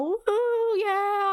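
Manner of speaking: high-pitched mock whoop; drawn-out high woo; imitated crowd cheer.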